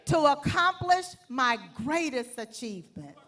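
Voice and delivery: loud rhythmic lead line; loud pledge lead. Shown as a demonstration. Speaker leads the audience in a loud pledge. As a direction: call it out for the room to repeat. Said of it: "this time I want you to say it with some conviction".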